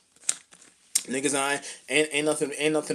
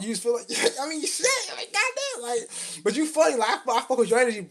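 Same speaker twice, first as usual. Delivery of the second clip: amused; 7 semitones higher, 4 dB quieter than usual. Retold or imitated. Voicing cool grandparent talk.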